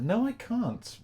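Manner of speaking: high-pitched, put-upon retort; mock protest. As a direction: squeal back at the voice in your head. Answers back the voice in his brain.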